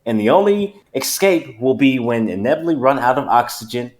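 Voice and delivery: put-on unpanicked country accent, measured phrases; Foghorn. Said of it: "I felt like I was being Foghorn".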